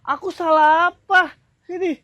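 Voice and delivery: high, drawn-out question; high-pitched, indignant; exasperated protest. Acted. Second take, he is exasperated.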